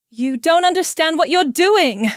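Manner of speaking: loud, high, angry warning; hissed anger. A narrator's reading. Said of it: "he hisses".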